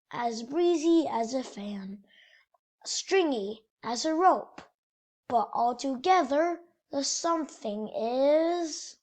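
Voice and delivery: lively picture book storytelling voice; reading to children. A narrator's reading. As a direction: read it like bedtime story time for kids. Reads picture book aloud.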